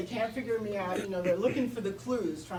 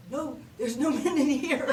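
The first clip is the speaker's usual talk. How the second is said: pitched-up voice; 7 semitones higher, 7 dB louder than usual. Retold or imitated. Hiding in the stall from the security guard, voice pitched up high. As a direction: voice pushed higher and louder to pass as a woman. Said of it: "I pitch my voice up".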